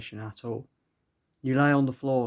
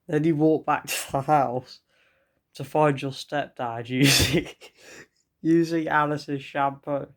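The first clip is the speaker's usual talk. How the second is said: reading through barely held-in giggles; fighting back laughter; 2 semitones higher, 4 dB louder than usual. Real, not acted. Reading the absurd story aloud, trying not to laugh. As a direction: keep cracking up mid-sentence. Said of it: "trying not to laugh on this next bit".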